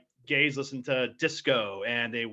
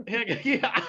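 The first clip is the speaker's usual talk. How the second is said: ordinary talk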